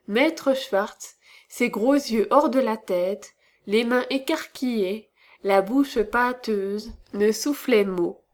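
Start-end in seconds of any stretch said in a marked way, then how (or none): none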